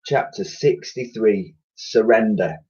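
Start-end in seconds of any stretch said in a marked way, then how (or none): none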